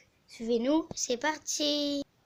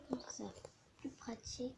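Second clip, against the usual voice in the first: whisper; 2 semitones lower, 19 dB quieter than usual. Real, not acted. Aside while placing the camera.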